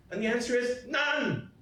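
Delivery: normal talking voice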